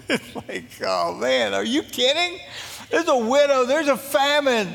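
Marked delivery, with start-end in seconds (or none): none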